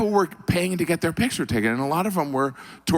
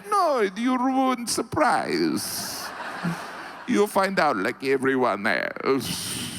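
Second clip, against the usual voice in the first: slow, drawn-out mock scolding; lightly voiced know-it-all; a hint of a prim scold, slowed right down; teasing refusal; 3 semitones higher, slower than usual. Russians voiced in a stand-up bit.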